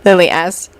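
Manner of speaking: ordinary delivery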